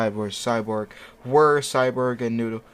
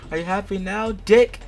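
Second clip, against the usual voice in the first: high-pitched taunt, louder last word; mock angry outburst; 8 semitones higher, 4 dB louder than usual. A narrator's reading. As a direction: jab the final word high and loud. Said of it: "I scream, surprising him a bit".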